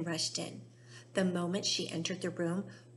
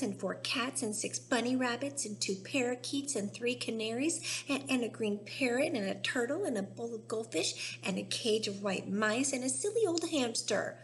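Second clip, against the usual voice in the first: whining tantrum; 4 semitones higher than usual. Acted. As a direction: demand it like a spoiled brat. Spoiled girl tantrum.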